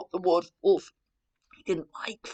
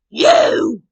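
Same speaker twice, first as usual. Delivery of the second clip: loud, sharp, higher shout; wolf's cry; 8 semitones higher, 15 dB louder than usual. Acted. Wolf springing at Granny, loud shout. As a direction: bark it out as the hungry wolf. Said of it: "cried the wolf".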